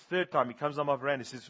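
usual speaking voice

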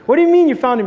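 loud, high-pitched reenacted disbelief; incredulous retort. Frantic dad questioning his calm wife, loudly incredulous. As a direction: throw the question back, astonished.

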